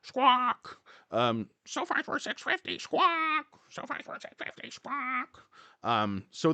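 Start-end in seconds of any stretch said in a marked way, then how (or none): 0.0-0.8 high, shrill-free mock bird squawk; playful parrot cry. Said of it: "the parrot". 1.7-5.4 high mock parrot squawking the words. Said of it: "the parrot".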